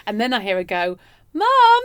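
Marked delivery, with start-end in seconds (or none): 1.3-1.8 high, loud call up the stairs. Retold or imitated. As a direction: shout for mum across the house. Girlfriend calls her mother.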